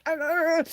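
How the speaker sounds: high imitated snarling "arr"; mock angry growl. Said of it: "if we're yelling at somebody".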